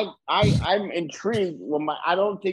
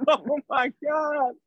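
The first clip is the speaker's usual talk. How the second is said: higher, laughing mock amazement; lightly voiced tourist; 10 semitones higher, slower than usual. Playing an amazed New York tourist for friends, mock awe. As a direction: gush like a starstruck visitor. Stays in caricature voice.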